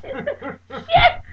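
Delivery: very high, loud panicked scream. Retold or imitated. Friend seeing the car go the wrong way, screaming. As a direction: shriek it in terror.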